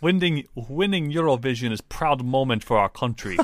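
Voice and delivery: proud, hammy Russian accent. Shown as a demonstration. Co-host showing skipped articles, Russian accent. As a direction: declare it in a mock Russian accent. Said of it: "no Russian equivalents for a or the".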